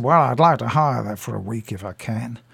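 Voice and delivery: a hint of an aging American film star; lightly voiced American lady; mild American accent. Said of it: "Do you like the accent, by the way?".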